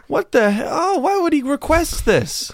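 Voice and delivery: high, shocked mourner's cry. Pretend. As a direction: gasp at the sight. Mourner at the funeral.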